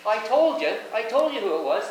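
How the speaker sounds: high, loud gloating; wife gloating loudly at her husband that she was right; triumphant I told you. Retold or imitated.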